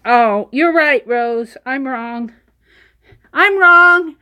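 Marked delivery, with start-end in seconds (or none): 3.3-4.1 loud and emphatic; loud admission. Real, not acted. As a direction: declare it out loud, owning the mistake. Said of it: "I admit when I'm wrong".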